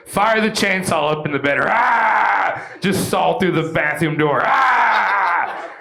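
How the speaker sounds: yelling over a roaring chainsaw; shouting. Acted. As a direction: shout it out wild. Acting out chainsaw attack.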